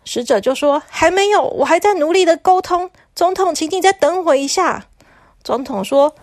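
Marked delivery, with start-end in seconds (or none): 0.9-4.9 higher, flustered envoy stalling; lightly voiced anxious messenger; nervous messenger. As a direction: beg for more time. Messenger answers president.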